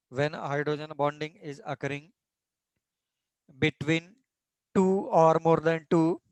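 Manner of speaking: slow, even dictation pace for notes. Acted. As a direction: spell it out slowly so students can write. Teacher dictating a definition slowly. (धीमी गति से लिखवाना)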